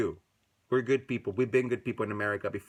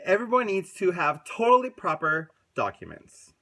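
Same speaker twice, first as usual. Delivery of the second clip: mocking the president; 7 semitones higher, 6 dB louder than usual. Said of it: "And he said".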